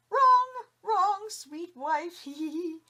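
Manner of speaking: high, loud, slow giggly teasing; husband teasing wife with gleeful giggles; giggling. A narrator's reading. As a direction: giggle through the words smugly. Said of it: "Li Tong giggled".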